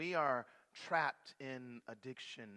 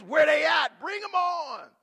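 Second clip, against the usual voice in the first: a strongman daring his enemies; cocky battle shout; 14 semitones higher, 19 dB louder than usual. Acted. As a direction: shout it high and loud, spoiling for a fight. Samson voiced, ready to fight.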